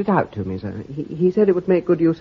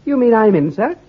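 delighted surprise, voice jumping up; can't believe he's been taken on; eager, startled disbelief; surprised; 7 semitones higher, 5 dB louder than usual. Acted. Surprised at being accepted.

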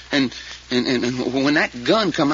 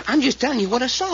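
higher-pitched, defensive insistence; protesting; 8 semitones higher than usual. Acted. Witness defending his account to Fay, protesting.

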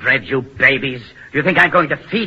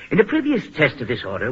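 ordinary talk

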